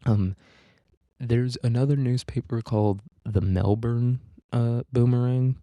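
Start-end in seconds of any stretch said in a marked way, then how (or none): none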